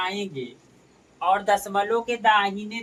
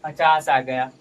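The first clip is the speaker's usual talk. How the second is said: पचास आ गया said much louder, firmly; emphatic repeat; 5 semitones lower, 8 dB louder than usual. Shown as a demonstration. Teacher repeats the answer to students, loud and firm. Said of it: "teacher repeats answer emphatically".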